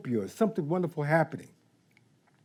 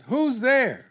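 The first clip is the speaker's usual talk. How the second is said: called out; 7 semitones higher, 3 dB louder than usual. Pretend. Calling out to the doorbell.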